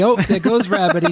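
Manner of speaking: the rap line repeated high, breaking into a laugh; laughing through the lyric. Retold or imitated. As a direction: sing it again, giggling as it goes. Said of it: "of course my brain goes".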